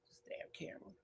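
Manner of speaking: under-the-breath whisper at the camera; whispered grumble. Real, not acted. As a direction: hiss it aside, unvoiced.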